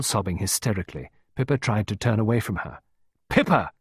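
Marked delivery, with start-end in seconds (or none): 3.3-3.7 raised, sharp call of the name; high, commanding call; shouting. A narrator's reading. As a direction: shout to get the child's attention. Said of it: "Clarissa shouted".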